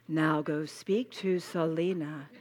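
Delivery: slow, low, soft divine voice; mock voice from above. Speaker mocking an imagined voice sending her to Selena, slow and low. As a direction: intone it slowly like a fake heavenly voice. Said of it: "hear this voice say".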